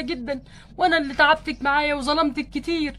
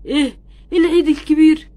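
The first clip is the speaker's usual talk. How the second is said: surprised; 8 dB louder than usual. Acted. Ken shocked the feast is near.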